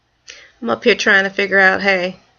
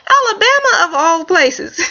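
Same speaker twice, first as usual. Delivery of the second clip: exclaimed Alabama; 7 semitones higher than usual. Real, not acted. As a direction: exclaim it in disbelief. Exclaiming of all places.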